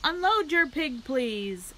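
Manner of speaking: loud, high announcer call; the fair announcer calling to the farmers, loudly; loudspeaker. A narrator's reading. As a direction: order it over the loudspeaker. Loudspeaker giving orders.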